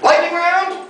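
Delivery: high-pitched announcing call. Real, not acted. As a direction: throw it out high as a question. Calling out the game's next round.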